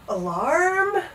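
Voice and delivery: high drawn-out yawned question; yawning. A narrator's reading. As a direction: yawn the word, rising sleepily. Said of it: "yawned the trumpeter".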